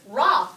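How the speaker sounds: "ra" loud and set apart; stressed syllable. Shown as a demonstration. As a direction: punch "ra" to single out the syllable. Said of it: "becomes the second to last syllable".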